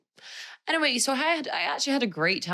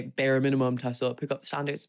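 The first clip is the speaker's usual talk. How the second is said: ordinary delivery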